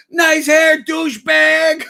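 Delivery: high, loud, drawn-out heckle; mock-holler it like out a car window; imitated window yell. Friend heckling strangers from a car, yelled high. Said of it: "Duhop would yell out the window".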